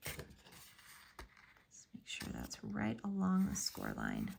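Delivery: slow, soft whispered aside. Real, not acted. Crafter quietly guiding viewers while she glues, whispered. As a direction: breathe the instruction slowly and softly.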